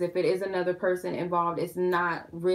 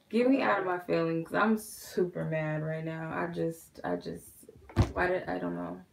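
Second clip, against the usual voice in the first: normal talking voice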